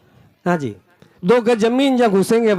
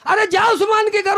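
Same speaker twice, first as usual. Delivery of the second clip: calling out; 10 semitones higher than usual. Acted. Prophet sends him off again.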